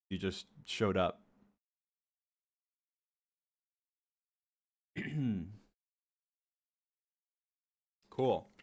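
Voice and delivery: congested, sick-sounding voice; stuffy nose. Real, not acted. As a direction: talk through a blocked nose. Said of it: "You sound sick".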